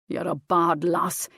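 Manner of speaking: grumbling. A narrator's reading. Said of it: "he grumbled".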